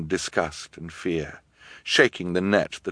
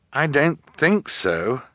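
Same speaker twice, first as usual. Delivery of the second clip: slow, higher-pitched sad reply; 8 semitones higher, slower than usual. A narrator's reading. Boy researcher with no escape idea, sad. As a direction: answer slowly, with a touch of sadness. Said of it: "Klaus replied sadly".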